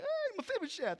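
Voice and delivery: high, bright hail of the name; excited greeting. Acted. King greets the fallen Mephibosheth, thrilled and high. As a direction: greet him like a long-lost friend. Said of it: "he's like".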